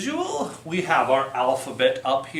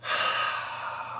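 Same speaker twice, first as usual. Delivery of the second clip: deep sigh; 7 dB quieter than usual. Shown as a demonstration. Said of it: "And a deep breath".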